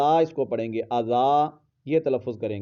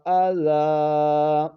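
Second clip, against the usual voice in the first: slow, melodic, each word set apart; teacher's model recitation; Quranic recitation; 4 semitones higher, much slower than usual. Shown as a demonstration. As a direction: chant the verse with long pauses. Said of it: "پہلے ہم اس لفظ کو پڑھتے ہیں".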